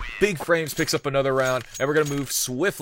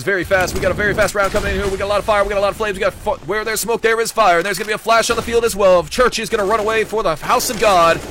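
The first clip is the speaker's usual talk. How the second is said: fast, loud, higher rattling patter; auctioneer voice; 5 semitones higher, 5 dB louder, faster than usual. Shown as a demonstration. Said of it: "the auctioneer voice was".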